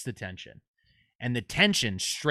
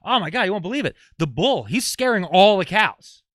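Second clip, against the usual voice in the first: put-on excited kid; 6 semitones higher, 7 dB louder than usual. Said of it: "He goes".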